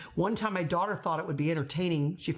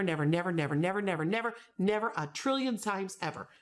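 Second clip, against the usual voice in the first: usual speaking voice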